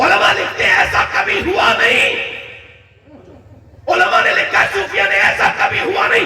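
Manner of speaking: high, loud, fervent proclamation; full-force preaching. Acted. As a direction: drive each phrase out in a strained shout to the crowd. (बुलंद आवाज़ में जोशीली तक़रीर)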